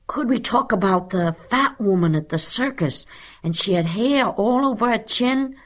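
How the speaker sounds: put-on high-pitched child's plea; begging, reminiscent pupil. A narrator's reading. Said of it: "begged Isidore Feinberg reminiscently".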